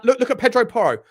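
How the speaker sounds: ordinary talk, nothing marked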